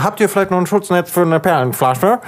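polite shop customer. Retold or imitated. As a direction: play an overly polite customer. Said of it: "so, so".

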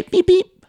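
high loud mock horn beeps; bike horn beep. Mom honking her bike horn at the kid, shrill beeps. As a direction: toot the little horn out loud.

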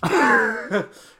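high, loud burst of laughter. Real, not acted. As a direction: crack up at your own impression. Laughing after the impression.

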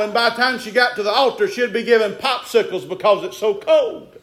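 rising, ringing preacher's cadence; preaching. Real, not acted. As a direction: preach it to the congregation. Preaching at full force.

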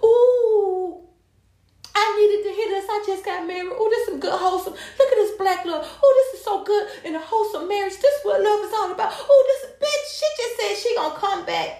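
high, laughing mock commenter gush. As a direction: ham up the sweet commenters swooning. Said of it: "I'm looking in the comments".

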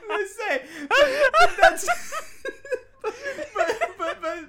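very high, squeaky broken words through laughter; helpless laughing. Real, not acted. Host struggling to finish the ramp joke, helpless laughing. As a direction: keep restarting as the laughter takes over. Too overcome by laughter to continue.

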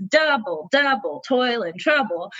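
"double, double" with weight on the first syllables; showing a falling meter; trochaic rhythm. Shown as a demonstration. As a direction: recite it like a chant. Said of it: "instead of trochees".

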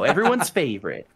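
ordinary talk

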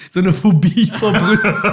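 laughing while talking; bursting out laughing. Real, not acted. Laughing at the comment.

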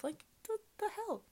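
high, disbelieving "the hell"; high-pitched what-the-hell; incredulous. Real, not acted. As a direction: scoff in disbelief. Incredulous outburst.

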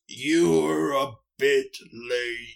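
slow Bug Bladder Beast voice. Acted. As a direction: drag it out like a smug monster king. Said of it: "and goes".

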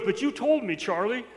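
emphatic mimicked appeal; imitated insistence. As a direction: press the point as the uncle. Uncle keeps insisting to the nephew.

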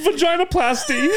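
high, loud, laughing fake wail; mock crying. Co-host mimics her crying over the prize, mock crying. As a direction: wail it as a hammed-up tearful confession. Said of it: "You're like".

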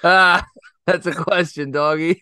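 high giggly teasing, laughing through words; laughing tease. Real, not acted. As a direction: poke fun at Timmy while laughing.

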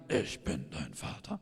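very low, put-on villain voice; mock villain father. Papa joking with his little son, deep mock-villain voice. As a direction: drop it deep like a movie villain.

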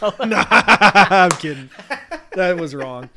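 laughing through the words. Real, not acted. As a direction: crack up while denying. Laughs at own joke.

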